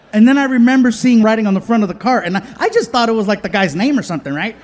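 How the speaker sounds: rushed telling. Real, not acted. Fast embarrassed telling.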